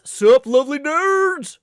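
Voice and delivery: loud, high, slow greeting; hammy impression of a friend; Badger's voice. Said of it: "Every time I try to do Badger's voice".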